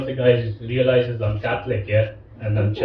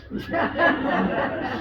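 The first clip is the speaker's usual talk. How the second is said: breathy laugh; 4 dB quieter than usual. Real, not acted. Laughs at own joke.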